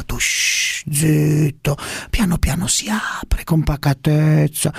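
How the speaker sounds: politician parody full of whispers and long shushes; Prodi. Acted. Parody statesman silencing the shouters, whispering and shushing. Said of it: "scusi, Prodi".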